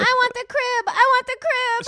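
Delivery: put-on high childish whine; baby voice. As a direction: voice a toddler demanding the crib. Baby voice in bed argument.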